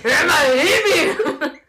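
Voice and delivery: mock Bavarian accent, rising high on Hebi; bad Bavarian accent. Joking about dialect in bed, a badly faked Bavarian accent, laughing. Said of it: "Der schlechteste bayerische Akzent".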